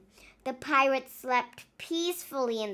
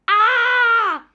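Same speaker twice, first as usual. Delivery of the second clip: loud, high mock scream; 9 semitones higher, 14 dB louder than usual. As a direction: shriek in fright. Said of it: "screamed Naughty Paul".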